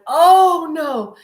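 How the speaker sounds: sudden high loud gasp of fright; startled cry. Retold or imitated. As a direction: cry out in shock as it touches you. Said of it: "And I went".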